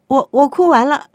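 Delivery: put-on high, loud child's proud reply; lightly voiced little child. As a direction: chirp it like a small kid. Child answering.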